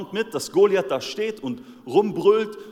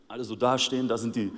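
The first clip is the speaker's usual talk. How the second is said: ordinary delivery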